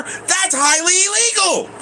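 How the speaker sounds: high, slow mocking voice of her. Mocking the woman who says his water use is illegal. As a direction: parrot her words back with scorn. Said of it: "But according to her".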